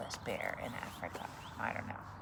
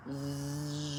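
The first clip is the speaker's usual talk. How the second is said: buzzing bee. Acted. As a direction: buzz like a bee circling. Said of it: "a bee came buzzing by".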